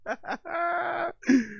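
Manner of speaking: high-pitched burst of laughter. Real, not acted. Host cracking up at a cringe clip, laughing.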